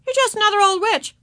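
put-on high-pitched child's retort; little boy's piping answer; dismissive small boy. A narrator's reading. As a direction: brush off a playmate. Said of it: "answered Davy".

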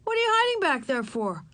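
put-on high-pitched boy's question; boy asking. A narrator's reading. Strange boy peering over cans, asking. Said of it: "asked the boy".

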